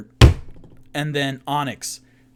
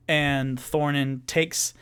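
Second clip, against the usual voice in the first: ordinary talk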